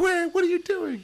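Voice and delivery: high-pitched mock protest; retold film character. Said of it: "He's like".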